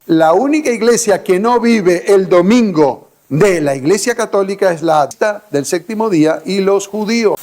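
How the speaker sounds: usual speaking voice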